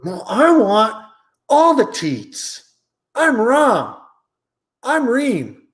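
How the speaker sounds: put-on high, giddy little boy; silly kid voice. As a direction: act like a greedy toddler. Greedy twin child voice.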